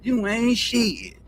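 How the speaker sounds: slow, stretched Southern country drawl; a country kid cussing slow and drawn out; hard country drawl. Retold or imitated. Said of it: "But it be in a country drawl like".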